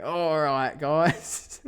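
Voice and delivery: hammed-up broad Australian, loud and high; thick Australian accent. Joking about a thicker accent for videos, exaggerated Australian. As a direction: lay the Aussie accent on thick. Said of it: "put a thicker one on".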